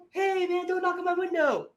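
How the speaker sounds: high-pitched comic complaint; mock protesting pilot. Jet pilot objecting to the man at his window, mock protest.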